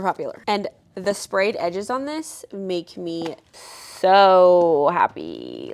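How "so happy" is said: delighted. Real, not acted. Delight at sprayed edges.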